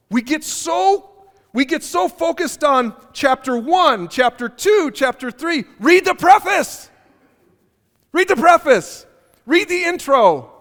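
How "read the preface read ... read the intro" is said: emphatic preaching. Real, not acted. Pastor urging congregation, preaching force.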